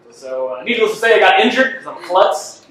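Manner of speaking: ordinary talk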